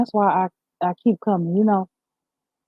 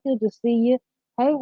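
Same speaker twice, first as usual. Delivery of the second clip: ordinary talk, nothing marked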